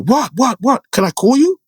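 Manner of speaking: high, urgent what what what; excited quick questions. Retold or imitated. Friend on the phone hearing bad news, alarmed and high. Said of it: "He's like".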